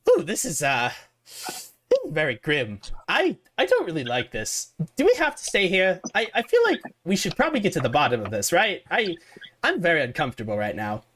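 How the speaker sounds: fretful and jittery, wanting out; Warwick's nervous voice. Acted. The rat-man Warwick telling the party he wants to leave, nervous. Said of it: "says Warwick".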